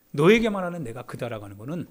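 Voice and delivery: normal talking voice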